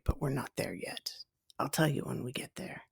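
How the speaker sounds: soft unvoiced whisper, leaning in to the listener; teasing whisper. Real, not acted. Author teasing listeners that the choice comes later, whispered. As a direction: whisper it like a little secret you'll reveal later. Teasing aside before the story.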